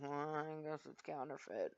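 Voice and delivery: low, soft mock muttering; onlookers' suspicious thought. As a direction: imitate a bystander thinking suspiciously. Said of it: "they're thinking".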